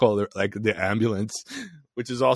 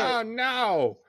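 silly clown; 13 semitones higher than usual. Pretend. Clown bit continues.

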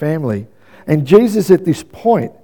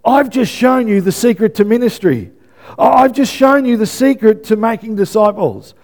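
fervent preaching; 7 semitones higher than usual. Acted. Preacher proclaiming Jesus' words to the congregation, fervent. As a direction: build each line like a declaration.

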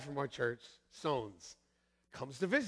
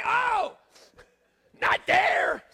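panicked yelp; 8 dB louder, much slower than usual. Retold or imitated. Nurse sticking another needle.

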